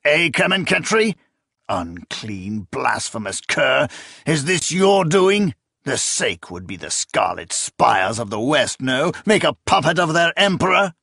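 raging accusation. Acted. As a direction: rail at the traitor. Emperor raging at sorcerer.

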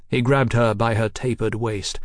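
usual speaking voice

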